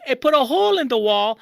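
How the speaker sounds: ordinary delivery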